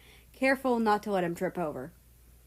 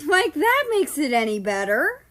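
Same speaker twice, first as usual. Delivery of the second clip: higher, louder deadpan; sarcastic; 7 semitones higher, 7 dB louder than usual. A narrator's reading. Australia retorts to the boys, sarcastic. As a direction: throw the line back at him.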